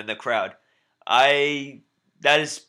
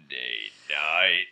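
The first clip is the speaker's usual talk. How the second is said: much lower, slow announcer boom; 10 semitones lower, slower than usual. Acted. Still trying the announcer voice for his co-host. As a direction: pitch it deep like a ring announcer.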